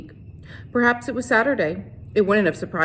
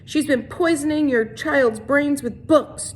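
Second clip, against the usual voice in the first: declaring; 7 semitones higher, slower than usual. A narrator's reading. Trout rousing the mob against the teacher, slow high declaration. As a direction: proclaim it slowly to the mob. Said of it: "Trout declared".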